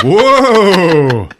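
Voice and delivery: high, loud, long whoa; rising then falling mock howl; imitated wail. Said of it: "And it's like".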